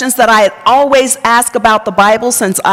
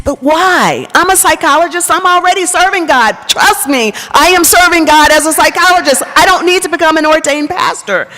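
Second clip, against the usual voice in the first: higher, insistent pleading against the call; retold protest, pitch raised and pressing; defensive protest; 7 semitones higher than usual. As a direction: argue you don't need to be ordained, voice pushed up.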